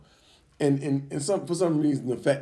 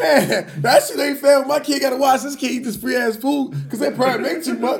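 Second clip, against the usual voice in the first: much higher and louder, laughing through the rant; laughing outrage; 11 semitones higher, 6 dB louder than usual. Real, not acted. Father ranting about free lunch kids, loud laughing outrage. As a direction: rant it, cracking up at the unfairness.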